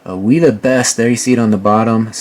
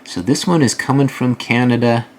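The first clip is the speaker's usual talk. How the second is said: ordinary talk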